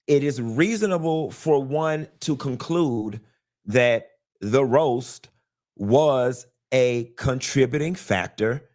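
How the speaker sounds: ordinary talk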